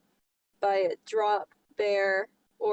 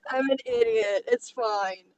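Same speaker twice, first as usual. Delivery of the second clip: ordinary delivery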